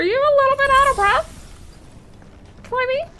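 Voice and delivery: playful high-pitched ribbing of a winded hero; teasing squeaky voice. Pretend. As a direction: tease him in a high squeak. Teasing game character Clay.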